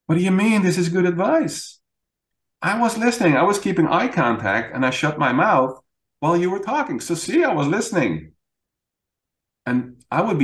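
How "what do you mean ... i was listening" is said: much higher, indignant I was listening; frustrated protest. Retold or imitated. Husband imitating his frustrated self protesting to his wife. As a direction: defend yourself, baffled and annoyed. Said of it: "I'd be frustrated".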